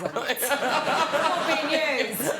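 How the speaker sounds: slow, laughing aside; drawn out through a laugh. Real, not acted.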